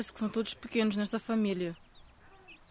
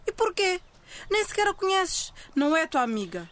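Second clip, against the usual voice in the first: snapping; 6 semitones higher than usual. Acted. Snapping at her friend.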